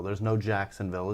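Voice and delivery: normal talking voice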